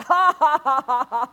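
a loud burst of ha-ha, imitated; mocking laugh. A man laughing at the enemy's lie, mock ha-ha. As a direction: laugh straight in the enemy's face. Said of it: "I'm laughing at you".

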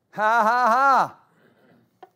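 loud, drawn-out triumphant "ha, ha, ha"; exultant ha-ha. Real, not acted. Preacher rejoicing over God's oath, exultant laugh. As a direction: crow it out in three loud bursts.

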